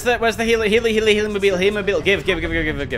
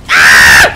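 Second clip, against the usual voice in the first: sudden loud raw yell; frustrated yell; 12 dB louder than usual. Real, not acted. Gamer yelling at the game, a loud raw yell. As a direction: bellow in frustration.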